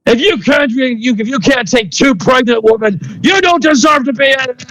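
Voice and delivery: high, furious shout; angry yelling. Acted. Masked man yelling at the doctor about escaped prisoners. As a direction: hurl the insult in rage. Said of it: "He starts, like, yelling".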